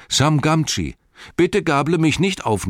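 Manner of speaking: high, loud hail; calling out. A narrator's reading. Sam to Nips with the hay fork, high loud call. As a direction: call out high to hold off an attack. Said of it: "rief Sam".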